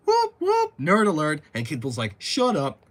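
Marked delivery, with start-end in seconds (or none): 0.1-1.4 mock siren. Mocking siren call. 2.2-2.8 put-on kid retort. Kid retort in quoted dialogue.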